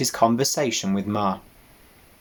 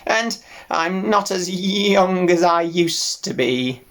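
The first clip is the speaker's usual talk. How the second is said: put-on slow, high bleating old sheep; old ewe voice; 8 semitones higher than usual. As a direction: drawl it like a tired old ewe.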